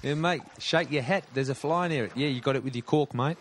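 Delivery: mock-Aussie bloke teasing his mate; put-on Australian accent. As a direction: tease in a hammed-up Australian accent.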